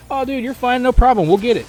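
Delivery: high, upbeat reassurance; light mock-friendly dude voice; chill teammate. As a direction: shrug it off for the teammate's sake. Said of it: "people are so chill".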